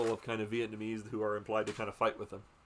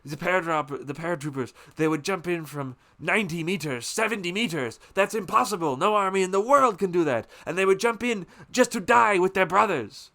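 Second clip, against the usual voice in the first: higher and louder, swelling through "No army in the world"; passionate tribute; 7 semitones higher, 8 dB louder than usual. Retold or imitated. The father tells Willard of the paratroopers, swelling proudly. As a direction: build with proud awe. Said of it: "the father starts going".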